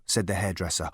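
ordinary delivery, nothing marked

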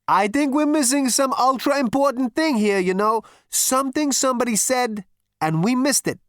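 high, loud, brash character voice; Zaphod. Acted. Zaphod's figure voice in the story.

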